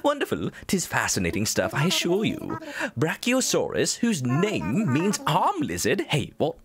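excited hooting curator with big pitch swoops; theatrical, delighted scholar voice; Blathers, enraptured. Acted. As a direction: lecture grandly on a fossil, high bursts on the exclamations. Voices the museum character's dialogue.

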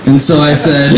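ordinary talk

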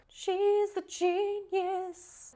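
high, slow, drawn-out declaration; drawn-out praise. Real, not acted. Fan praising the smart girl on screen, high and drawn out. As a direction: stretch it out high in admiration. Gushes over admired character.

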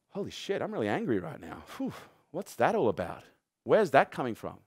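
quiet surprised self-check; quiet self-aware reflection. Retold or imitated. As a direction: step back and murmur it to yourself.

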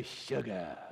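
low, soft swaggering "sugar". Shown as a demonstration. Pastor leading everyone to say it, with swagger. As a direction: draw it out low with attitude. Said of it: "say it with some kind of swag".

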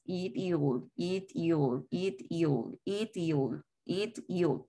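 "It, you'll" softly split into two beats, IT-ul; dos sílabas. Shown as a demonstration. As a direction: separate "It" from "you'll", two quiet syllables. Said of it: "en esta pronunciación tiene dos sílabas". ("It, you'll" suave, en dos sílabas separadas)

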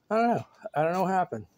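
ordinary talk, nothing marked

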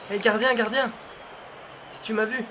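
ordinary talk, nothing marked

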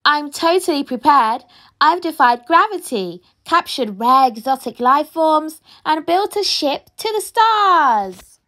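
ordinary delivery

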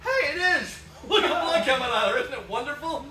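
usual speaking voice

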